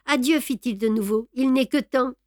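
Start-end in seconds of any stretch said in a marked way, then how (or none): 0.1-0.4 un peu plus haut, satisfait et moqueur; greffier narquois. Acted. Greffier prend congé. 1.3-2.1 un peu plus haut, satisfait et moqueur; greffier narquois. Acted. Greffier pressé.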